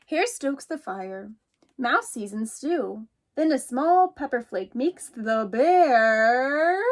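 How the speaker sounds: a little mouse calling out, startled. Acted. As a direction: call out as if someone just knocked. Teacher reads picture book aloud.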